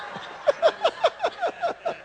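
high, drawn-out laugh; long amused cackle; hearty laugh. Real, not acted. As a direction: laugh helplessly at the answer. Laughs at audience's answer.

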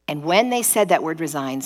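ordinary delivery

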